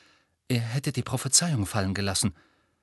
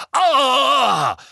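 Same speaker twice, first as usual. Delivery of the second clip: high loud yell; battle cry; 11 semitones higher, 7 dB louder than usual. A narrator's reading. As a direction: shout as you charge in. Neville charging in.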